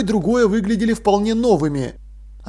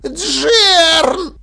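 very high, loud wail of a name; alarmed cry; 11 semitones higher, 6 dB louder than usual. Acted. Dil calls apprentice once more, alarmed.

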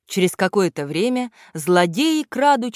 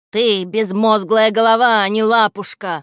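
loud, cross telling-off; angry scolding; 4 semitones higher, 6 dB louder than usual. A narrator's reading. Villain boss berating his clumsy henchman, angry scolding. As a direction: scold the dimwit, raising your voice. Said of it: "ругался Кляксич".

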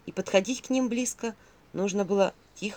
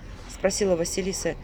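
ordinary delivery, nothing marked

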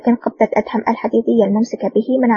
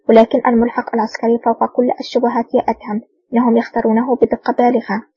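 ordinary delivery, nothing marked

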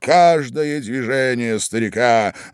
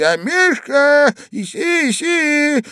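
high, loud coaxing calls; old man calling; 14 semitones higher, 4 dB louder, slower than usual. A narrator's reading. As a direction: call a dog from under the bed. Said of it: "кричал старичок, щёлкая пальцами". (старичок громко подзывает собачку)

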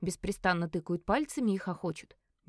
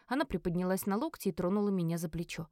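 ordinary delivery

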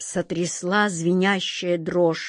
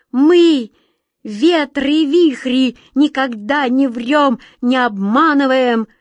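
high, loud, slow indignant insistence; offended protest; 5 semitones higher, 7 dB louder, slower than usual. A narrator's reading. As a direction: defend your honour, hurt.

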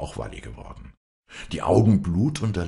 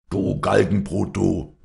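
loud, raised curse from a father; angry shout; 4 semitones higher, 6 dB louder, slower than usual. A narrator's reading. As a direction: hurl the insult at your daughter. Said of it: "schrie Stromminger".

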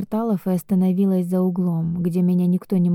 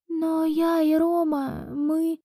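timid high voice; 9 semitones higher, 3 dB quieter, slower than usual. Acted. Heroine pleads with his father.

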